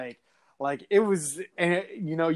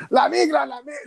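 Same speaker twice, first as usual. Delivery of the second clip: high, panicked shouting in Spanish; high mock yell of a spooked worker; retold frantic yell; 11 semitones higher, 5 dB louder, faster than usual. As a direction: yell it like a scared man fleeing. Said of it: "yelling fucking".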